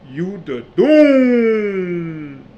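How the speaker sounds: slow, loud, stretched-out yell of the name; drawn-out shout. Retold or imitated. Friend declaring him the Doom, drawn-out shout. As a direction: belt out the Doom like a verdict. Friend's punchline, everyone laughs.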